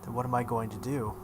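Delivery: ordinary delivery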